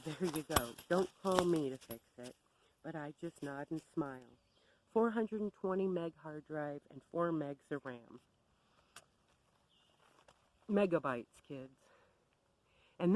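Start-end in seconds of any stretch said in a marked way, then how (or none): none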